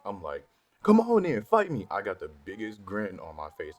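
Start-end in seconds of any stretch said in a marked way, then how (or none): 0.8-1.9 loud, high shouted dare; yelling fight me across the classroom. Retold or imitated. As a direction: bellow it with a grin. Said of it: "I started yelling".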